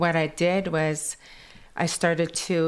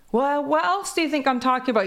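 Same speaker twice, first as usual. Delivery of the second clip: granny's retort; 9 semitones higher, 4 dB louder, faster than usual. Retold or imitated. Granny quoted, exasperated.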